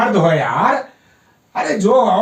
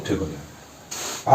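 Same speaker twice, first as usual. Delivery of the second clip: whisper; 12 dB quieter, slower than usual. Real, not acted. Therapist soothes patient after pain.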